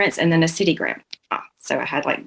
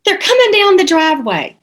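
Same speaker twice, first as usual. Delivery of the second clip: put-on high raised voice of a grandma calling out to the kid; imitating grandma calling; 9 semitones higher, 4 dB louder than usual. Grandma warning Angie the church people are coming, high and calling. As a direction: call it up like grandma at the window. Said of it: "she'd say".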